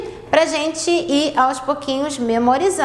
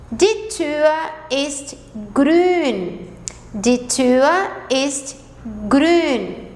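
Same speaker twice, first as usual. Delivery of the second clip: Die Tür ist grün, very slow and clear; slow model sentence; much slower than usual. Shown as a demonstration. Teacher rereading German sentences slowly for beginners. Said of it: "Vou ler mais uma vez as quatro frases".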